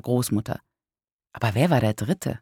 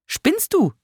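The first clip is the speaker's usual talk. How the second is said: very high, incredulous outcry; aunt's exclamation. A narrator's reading. Aunt challenging the narrator's claim, exclaiming. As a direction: shoot the pitch right up in disbelief. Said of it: "rief meine Tante".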